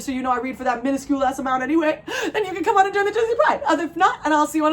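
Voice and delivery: fast, high words through laughter; real laugh breaking in. Real, not acted. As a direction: giggle your way through it. Laughing at small audience joke.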